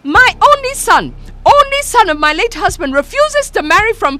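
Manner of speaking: very high, loud curse; a widow cursing the girl; shrill furious Mama. A narrator's reading.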